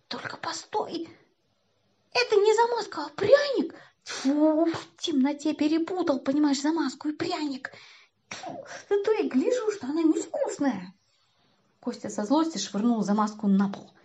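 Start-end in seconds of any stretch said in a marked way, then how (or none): 0.1-10.9 put-on high, whiny boyish dismay; disgusted realization. A narrator's reading. Kostya finds he ate putty, disgusted. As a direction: spit out the nasty taste. Boy discovers he bit putty.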